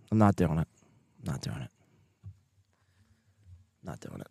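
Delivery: refuse, sinking almost to nothing; fading refusal. Real, not acted. Refusing chat's demand, increasingly quiet and low.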